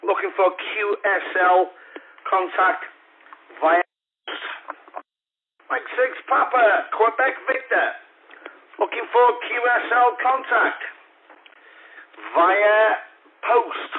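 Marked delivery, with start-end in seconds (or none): 5.7-13.9 higher, projected call sign; pitched-up ham radio call; raised radio call. Acted. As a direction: call out over the airwaves.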